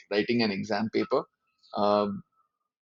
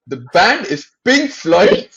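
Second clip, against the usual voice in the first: louder, higher and slow, spelling it out in disbelief; incredulous; 8 semitones higher, 11 dB louder, slower than usual. Retold or imitated. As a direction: hammer each word at the teammate who got it wrong. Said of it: "I turn to Abhin incredulously".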